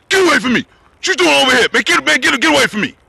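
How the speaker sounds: loud gruff brush-off; gruff shout. Retold or imitated. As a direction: shout him away. Uncle scolds the man.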